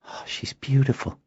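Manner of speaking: high, soft admiring sigh; tender awe. Acted. Noella feels the watchware, tender awe. Girl touching creature in wonder.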